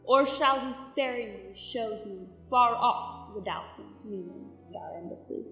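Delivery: hushed, careful request to a king; soft diplomatic caution; deferential envoy. Acted. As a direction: speak softly, treading carefully before royalty. Envoy cautiously asks leave to speak.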